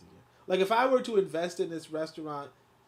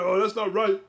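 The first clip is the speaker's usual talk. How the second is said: mock complaint; 5 semitones higher, 8 dB louder than usual. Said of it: "you could be like".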